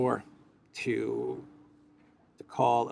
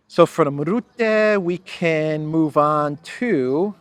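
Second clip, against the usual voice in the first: mock-grand; 5 semitones higher, 9 dB louder, much slower than usual.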